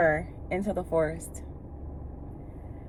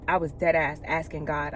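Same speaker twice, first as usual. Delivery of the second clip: normal talking voice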